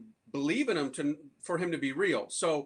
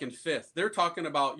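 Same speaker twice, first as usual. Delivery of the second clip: usual speaking voice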